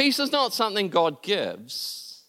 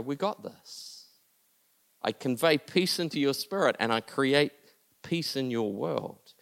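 tender blessing; 4 semitones lower, 6 dB quieter than usual. Real, not acted. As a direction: pray it gently over them. Pastor prays blessing over listeners.

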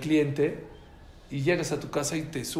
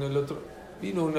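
normal talking voice

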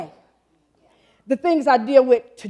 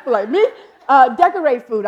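laughing; 8 dB louder than usual. Real, not acted. Laughing at her own slip.

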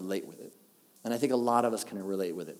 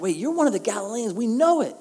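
high, loud accusation; a crowd catching him out; accusing crowd; 10 semitones higher, 6 dB louder than usual. Acted. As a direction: bystanders accusing Peter in high, loud voices. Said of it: "they're all like".

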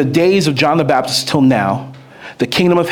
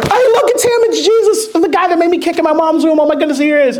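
much higher, giddy John the Baptist; excited John. Retold or imitated. Preacher voicing John thrilled to see Jesus, high and giddy. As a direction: squeal it like a starstruck fan. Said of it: "John's like".

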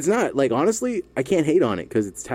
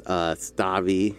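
usual speaking voice

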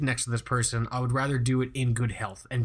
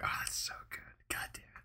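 hushed whisper to himself; 15 dB quieter, faster than usual. Real, not acted. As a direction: breathe it out under your breath.

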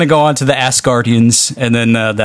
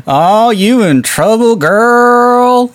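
higher, louder, slow sassy call at the screen; mock loud moviegoer; 8 semitones higher, 3 dB louder, much slower than usual. Imitating theater crowd yelling at the screen. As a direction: drag it out like a heckler in the seats. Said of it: "people in the theater going".

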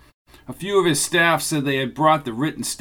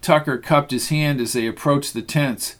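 ordinary delivery, nothing marked

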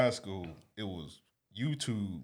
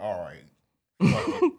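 giving up; 8 dB louder, slower than usual. Retold or imitated. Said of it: "he was like".

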